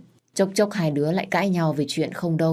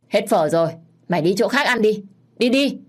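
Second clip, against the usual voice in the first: higher, louder dismissal ending in a shout; rising urgency, pitched up by the end; brusque shooing away; 5 semitones higher, 4 dB louder than usual. A narrator's reading. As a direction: shoo a customer off impatiently. Shopkeeper shooing a customer away.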